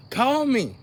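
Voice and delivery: calling out. Real, not acted. Calling out to the audience.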